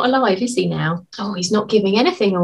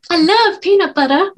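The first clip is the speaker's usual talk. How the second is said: camp it up on love; 9 semitones higher than usual. Retold or imitated. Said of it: "make me really camp".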